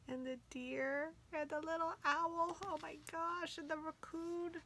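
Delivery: cooing. Real, not acted. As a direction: coo over the little animals. Cooing over cute animals.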